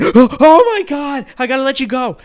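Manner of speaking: put-on high, loud shocked babysitter hanging up fast; shocked gasp. Babysitter on the phone shocked by the ketchup, loud and high. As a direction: clutch the pearls and cut the call.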